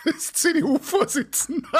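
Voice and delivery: laughing through the news; cracking up mid-line. Real, not acted. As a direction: giggles breaking into the words. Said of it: "ich muss gerade lachen".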